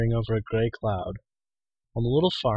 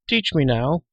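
pleading; 5 semitones higher, 5 dB louder, slower than usual. A narrator's reading. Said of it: "pleaded Taran".

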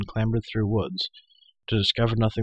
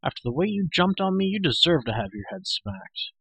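mildly exasperated scolding; impatient outburst; 7 semitones higher than usual. A narrator's reading. Youth scolding the creature who jumped him, impatient. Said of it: "could no longer control his impatience".

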